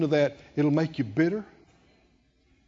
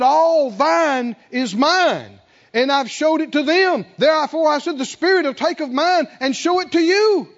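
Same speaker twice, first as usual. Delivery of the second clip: building preaching; 8 semitones higher, 7 dB louder than usual. Acted. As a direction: preach each promise harder, climbing to a shout. Preaching at full force.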